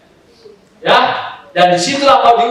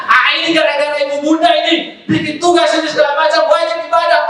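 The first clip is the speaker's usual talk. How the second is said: higher, grumbling student complaint; mock complaining student; 8 semitones higher than usual. Preacher mimicking students blaming the teacher, higher whiny grumble. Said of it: "kucing-kucingan".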